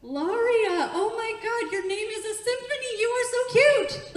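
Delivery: high, loud squealing admiration; gushing compliments. Shown as a demonstration. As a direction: swoon loudly over the name. Gushes over second audience member.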